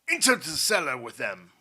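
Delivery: loud, forceful command; barked order. A narrator's reading. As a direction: order the henchman with authority. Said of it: "ordered General Kalik".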